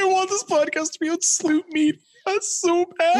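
high whiny mock crying; mock whine. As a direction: whine like a kid begging. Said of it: "Nick's crying".

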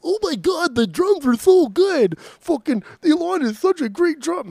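high, slow swooning mock gush; mocking gushing fan. Teasing his cohost by imitating him gushing, high mock swoon. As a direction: ham up a starstruck fan gushing over drums. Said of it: "you're gonna be like".

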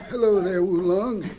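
ordinary talk, nothing marked

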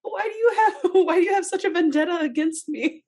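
very high, laughing wronged ghost; seething shrieking spirit. Pretend. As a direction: squeal the complaint through laughter. Voices the woman, laughing.

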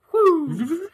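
high, loud trilled tape-rewind noise; rewind sound effect. Pretend. Host mimics rewinding the episode, high whirring noise. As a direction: spin the tape back with your voice. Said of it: "rewind the episode".

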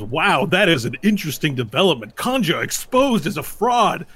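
dramatic esports caster, stretching key words; shoutcaster. Acted. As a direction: announce the scandal with big swooping emphasis. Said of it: "the shoutcaster comes up and goes".

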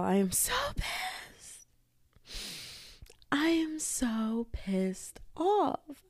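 slow, exaggerated pissed-off; dramatic slow gripe; drawn-out annoyance. Real, not acted. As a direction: stretch the words out, fed up. Rant opener, drawn out.

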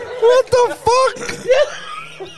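high, stretched out through laughter; laughing disbelief. Real, not acted. Friend reacts to the fridge joke, laughing high and slow. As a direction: wheeze it out while dying laughing.

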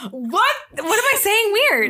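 high, drawn-out amused bewilderment; baffled protest. Real, not acted. Host to co-host who keeps correcting her, high and baffled.

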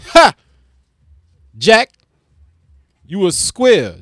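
ordinary delivery

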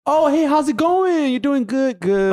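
high, slow polite greeting; fake phone voice. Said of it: "someone calls me like".

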